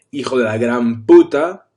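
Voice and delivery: loud, forceful Spanish curse; really strong. Shown as a demonstration. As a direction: hurl the curse out loudly. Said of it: "it comes out and it's really strong".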